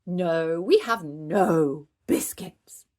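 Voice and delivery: slow, loud puppet refusal; drawn-out, emphatic denial; grave refusal. A narrator's reading. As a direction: stretch out a solemn no. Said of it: "shaking Monkey's head".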